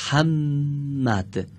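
محمد drawn out very slowly, the doubled م held long; slow demo word. Shown as a demonstration. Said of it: "دقت کنن همه".

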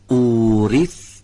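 اوریثتموها with the opening "او" held long; elongated first vowel. Shown as a demonstration. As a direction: stretch the first sound of اوریثتموها as a teacher's model. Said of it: "کشیده هست. بعد بگیم".

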